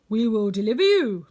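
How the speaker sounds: a king's proud command; monkey king. Acted.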